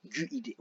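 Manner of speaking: "Gu-I-D" with the u heard between g and i; U wrongly sounded. Shown as a demonstration. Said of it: "on dit pas gu-I-D". (« Gu-I-D » plus doucement, avec le u prononcé)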